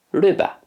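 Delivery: "ryba" said RIH-ba, pitched high; Polish word repeated. Shown as a demonstration. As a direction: echo "ryba" brightly for learners. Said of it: "in Polish it's ryba".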